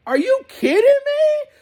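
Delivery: high, loud incredulous outburst; mock outraged squeal; big mad. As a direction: act out a furious driver's disbelief. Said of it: "she was a big mad".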